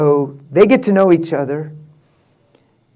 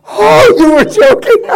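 much higher, louder realization; relieved surprise; 7 dB louder than usual. Retold or imitated. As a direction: gasp it out as the joke dawns. Girl realizing it was a joke.

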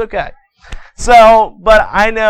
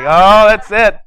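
louder, mock-angry mom outburst; mad mother; 3 semitones higher, 6 dB louder, slower than usual. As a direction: erupt like a mom who's had enough. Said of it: "Oh, she's mad now".

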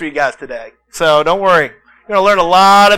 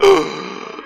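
sharp breathless gasp; mock exhausted gasp. Wrestler retelling practice, a mock exhausted gasp. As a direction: gasp like you're out of air. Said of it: "We're like".

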